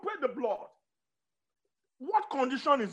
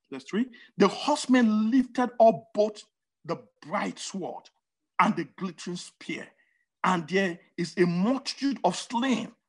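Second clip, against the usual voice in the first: slow, weighty reading of the verses; dramatic scripture reading; 5 semitones lower than usual. Acted. Preacher reading Nahum to the congregation, slow and weighty. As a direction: lean into each image as you read.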